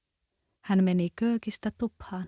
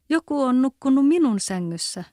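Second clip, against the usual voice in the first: higher, louder little bear cub; 6 semitones higher, 7 dB louder than usual. A narrator's reading. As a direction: squeak it out as the tiny bear. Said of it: "hänen pikkuäänellä". (pienen karhunpennun korkea ääni)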